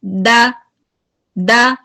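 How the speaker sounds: a heavy, clipped द; strong द. Shown as a demonstration. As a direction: demo of strong द. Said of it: "strong और high हो रही है".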